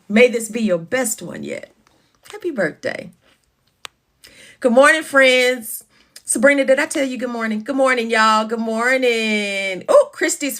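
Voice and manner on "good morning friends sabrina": ordinary delivery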